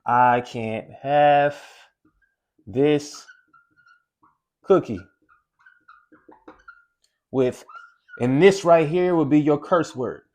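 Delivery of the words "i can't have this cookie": I can't have this cookie stretched very slow, pausing before this; drawn-out can't. Shown as a demonstration. Coach showing how people talk themselves out of the cookie, very slow. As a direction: drag out have and cookie slowly. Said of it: "You'll say".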